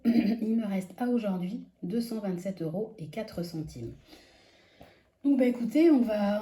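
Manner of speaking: usual speaking voice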